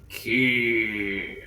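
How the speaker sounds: low, irate rumble; irate rumbling voice. Acted. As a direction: growl it like an angry demon. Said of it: "in that rumbly voice again, just very, like, irate".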